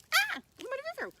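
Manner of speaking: high, loud, fast squeal; panicked mouse. Acted. Mouse spotting the swimming bunny, high panicked squeal. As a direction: blurt it in a cartoon shriek.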